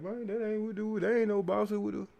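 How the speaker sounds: mock her in a high whiny voice. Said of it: "She like".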